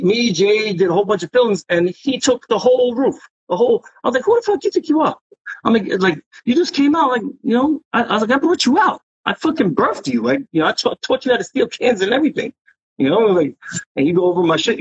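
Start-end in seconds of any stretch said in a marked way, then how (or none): 4.2-5.2 high-pitched mock outrage at a rival; retold angry challenge. Retold confrontation over painted wall. 6.5-12.5 high, indignant mock telling-off; retold scolding. Scolding rival he taught, retold.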